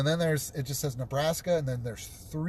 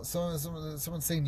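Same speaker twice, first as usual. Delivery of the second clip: normal talking voice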